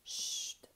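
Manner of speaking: whispered "Chut"; shushing. Shown as a demonstration. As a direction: hush like quieting a class. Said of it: "c'est comme quand on dit chut".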